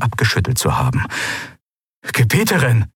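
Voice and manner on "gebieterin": Gebieterin cried out with a falling, pleading insistence; urgent protest. A narrator's reading. Aide protests the ruler's order, urgent. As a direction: step forward and protest.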